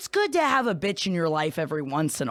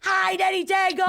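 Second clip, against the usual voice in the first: much higher shouted hello; excited shout; 13 semitones higher than usual. Real, not acted. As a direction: cheer the caller's name. Greeting caller with a shout.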